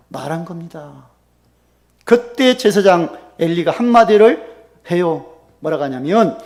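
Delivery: usual speaking voice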